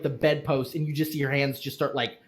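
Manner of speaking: ordinary delivery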